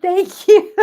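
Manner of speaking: high, loud thanks through laughter; laughing thanks. Real, not acted. Host thanking the viewer while still laughing, high and loud.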